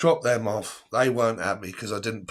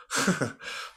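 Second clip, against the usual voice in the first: soft breathy laugh; 4 semitones higher, 5 dB quieter than usual. Real, not acted. Laughs at withheld detail.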